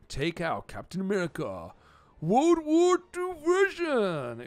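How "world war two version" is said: very high, loud, slow trailer-announcer voice; goofy high-pitched hype voice; mock announcer. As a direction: stretch it out like a hammed-up announcer. Announcing the hero's version.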